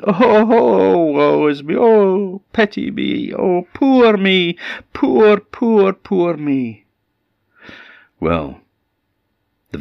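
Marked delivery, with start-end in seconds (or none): 0.0-6.9 very high, eerie drawn-out lament; hammed-up wail of endless self-pity; terrible wailing voice. Voice from inside the cave, terrible wailing. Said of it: "it's got a terrible voice".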